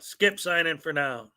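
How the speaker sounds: usual speaking voice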